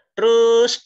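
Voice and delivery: a sudden loud "Terus," cutting him off; loud dismissive retort. Acted. Jesus brushing off Peter's boast with a loud call.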